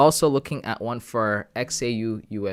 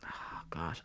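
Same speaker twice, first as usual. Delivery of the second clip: whispered, much softer; whispered worry; 6 semitones lower, 17 dB quieter than usual. Real, not acted. Streamer torn over a trade, anxious whisper. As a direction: breathe it under your breath.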